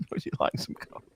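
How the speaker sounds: soft breathy whisper with no voice; a teasing whisper of the same line; whispered echo. Pretend. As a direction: whisper the offer back as a joke. Echoing the mock soda offer.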